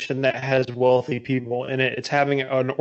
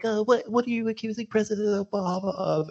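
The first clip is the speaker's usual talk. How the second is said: mock-timid, high and soft reporter voice; 7 semitones higher, 5 dB quieter than usual. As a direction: squeak out the question meekly, imitating a cowed reporter. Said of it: "goes up there all timidly".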